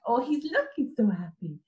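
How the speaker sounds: normal talking voice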